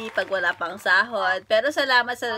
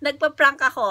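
ordinary talk

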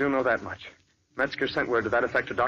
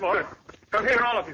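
higher, urgent call to the others; urgent summons; 9 semitones higher than usual. Acted. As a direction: hurry them over. Finds body, summons others.

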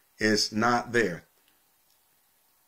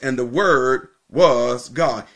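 slow, with "Word" and "was" stretched out; measured, emphatic "Word was God"; emphatic stress; slower than usual. Shown as a demonstration. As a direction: lean hard on "Word", then hold "was". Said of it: "The emphasis who the Word was".

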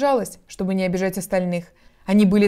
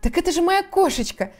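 put-on high-pitched, delighted little girl; eager, hurried; 9 semitones higher than usual. A narrator's reading. Alice introduces her beloved cat, eager and high. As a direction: jump in eagerly, pitch climbing. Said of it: "заторопилась Алиса".

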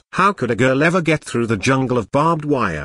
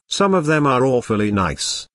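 usual speaking voice

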